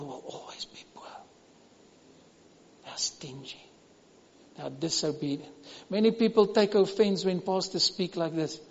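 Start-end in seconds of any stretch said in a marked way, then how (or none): 0.1-5.5 hushed unvoiced whisper. Real, not acted. Preacher whispering a hard truth to the congregation. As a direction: lean in and whisper it.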